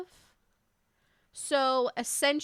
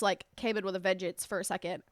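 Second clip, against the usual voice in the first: stuffy allergy voice; talking through allergies; 6 semitones lower, 8 dB quieter, faster than usual. Real, not acted. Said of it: "my allergies just".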